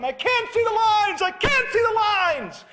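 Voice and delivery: loud, high-pitched panicked yell; frantic shout. Retold or imitated. Son on the tractor at night, yelling high and loud. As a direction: cry out in the dark, frantic. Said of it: "he's shouting".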